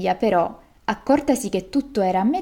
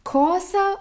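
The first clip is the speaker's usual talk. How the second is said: storyteller's mock yell; king's outraged cry; 10 semitones higher than usual. A narrator's reading. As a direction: burst out in royal disbelief. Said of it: "urlò il re".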